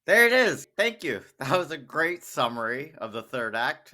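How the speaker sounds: normal talking voice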